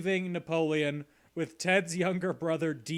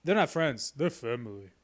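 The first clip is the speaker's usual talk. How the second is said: Vin Diesel; 3 semitones lower than usual. Retold or imitated. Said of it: "I didn't realize Vin Diesel was my co-host".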